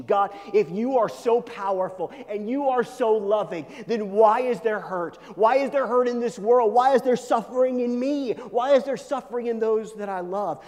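high, loud, insistent questioning of God; anguished challenge. Acted. Believer demands God explain the hurt, anguished challenge. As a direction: drive each repeated question harder.

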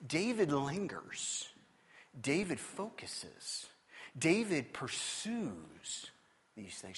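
very slow, quiet weighty lines; slow, hushed preaching emphasis; slow emphatic. Acted. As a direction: let each phrase land slowly.

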